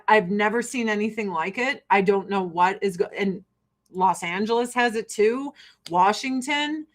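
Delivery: normal talking voice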